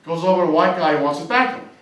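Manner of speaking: ordinary talk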